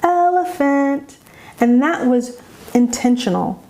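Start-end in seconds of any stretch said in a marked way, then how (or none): 0.0-1.2 "elephant" sung high and slow; high, melodic, drawn-out "elephant" after a brief pause; acoustic highlighting. Shown as a demonstration. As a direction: stretch "elephant" out on a high, held tune. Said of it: "a little bit of silence and space before I said".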